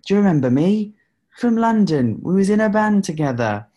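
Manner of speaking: high, loud mock British voice; hammed-up British caller, pitched right up and loud; weird British accent. Prank caller claiming an old band link, weird British accent. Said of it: "this weird, like, British accent".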